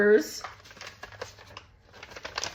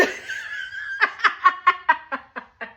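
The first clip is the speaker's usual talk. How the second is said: shrieking laugh leaping very high; real squealing laughter. Real, not acted. Laughing at the story.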